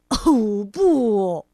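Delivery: loud shocked "oh no"; horrified cry. Acted. Mr. Fussy discovering the wrecked bathroom, loud shocked cry. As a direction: gasp it out at the sight. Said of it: "他惊叫起来".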